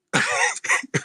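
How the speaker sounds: squeaky whispered giggle; a high airy burst of laughter; high breathy laugh. Real, not acted. Laughing at the owner's answer to the host, high and breathy.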